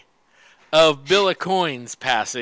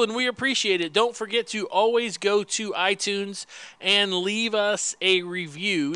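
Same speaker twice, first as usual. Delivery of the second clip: ordinary talk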